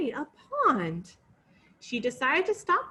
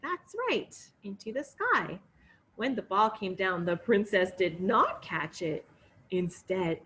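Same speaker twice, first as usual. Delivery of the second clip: usual speaking voice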